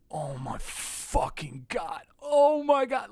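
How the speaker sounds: slow, broken sob rising into a high wail; imitating himself weeping in awe; overwhelmed crying. Replaying his own sobs on the trip, slow then high wail. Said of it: "you would've just kept hearing".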